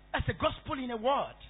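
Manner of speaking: usual speaking voice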